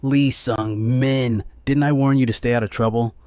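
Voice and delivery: loud angry shout, dragging the name. Acted. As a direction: bellow it at him in fury. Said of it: "her voice rising in a shout".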